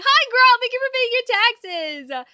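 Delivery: high, loud and slow; excited greeting. Real, not acted. Streamer thanks a viewer in a high, loud, stretched voice. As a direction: sing out the thanks.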